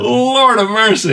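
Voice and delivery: high, slow, loud "Lord of mercy"; shocked exclamation. Real, not acted. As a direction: stretch it out in disbelief. Reacting to the fifty-one points.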